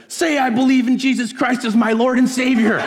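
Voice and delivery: high-pitched, loud shouted order; hammed-up high yell of a little bully; bullying demand. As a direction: shout it like a kid forcing a confession. Child bully demanding a conversion.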